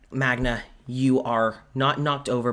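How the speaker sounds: ordinary delivery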